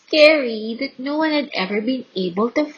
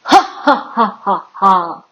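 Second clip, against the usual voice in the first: booming villain's laugh; ha ha ha like a gloating giant; terrible laugh; 5 dB louder than usual. A narrator's reading. Giant gloating over the scared army, booming laugh. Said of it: "he laughed this terrible laugh".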